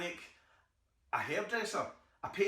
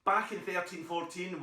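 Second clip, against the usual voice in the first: ordinary delivery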